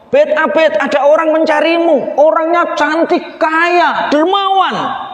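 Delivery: high call across the rice field. Acted. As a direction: hail him from afar. Villager calls out to Abid.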